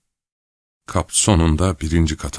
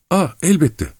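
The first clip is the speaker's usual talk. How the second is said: much higher, panicked yelp; startled cry; 10 semitones higher than usual. A narrator's reading. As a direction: blurt it out in a fright. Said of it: "diye bağırdı kontrol edemediği bir ses tonuyla".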